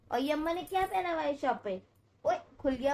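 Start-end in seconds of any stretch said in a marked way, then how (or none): none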